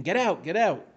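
loud urgent cry within a reading; mock scream. A narrator's reading. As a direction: raise the voice as if clearing a room. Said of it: "I ran toward them screaming".

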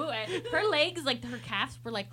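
ordinary talk

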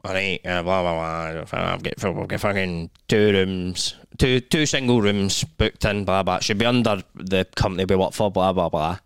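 mock low grumbling check-in; grumpy colleague. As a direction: play the fed-up coworker droning on. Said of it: "and he was like".

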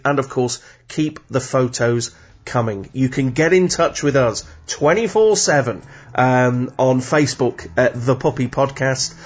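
ordinary talk

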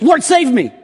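high, loud cry for rescue. Retold or imitated. Peter sinking in the water crying to Jesus, high and loud. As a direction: call out like a sinking man. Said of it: "he cries out to Jesus".

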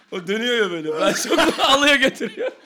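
loud laughter through words; laughing too hard to talk. Real, not acted. Laughing at his own joke.

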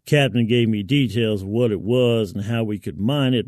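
usual speaking voice

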